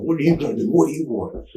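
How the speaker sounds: high-pitched retold challenge; pissed-off challenge. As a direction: square up to a cop, acted out. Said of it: "I'm still pissed off".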